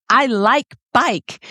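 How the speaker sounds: put-on slow, high little-kid voice; imitating a toddler, drawn out. Dad retelling his daughter's bike clip, in a slow high toddler voice. Toddler quote retold again.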